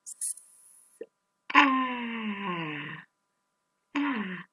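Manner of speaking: S then ah each sound alone. Shown as a demonstration. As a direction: split the word into its sounds. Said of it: "now let's say it again".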